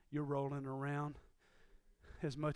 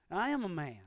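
ordinary delivery

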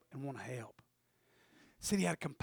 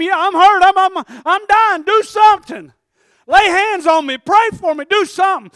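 high, loud begging cries; begging shout; 12 semitones higher, 20 dB louder than usual. Acted. Dying man begging passersby, high and loud. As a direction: scream for help from the roadside.

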